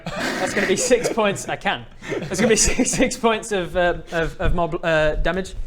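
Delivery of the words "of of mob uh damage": normal talking voice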